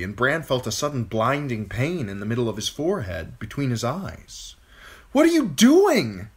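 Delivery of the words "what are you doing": high, loud, slow panicked shriek. A narrator's reading. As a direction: scream it at the attacking bird. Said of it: "he shrieked".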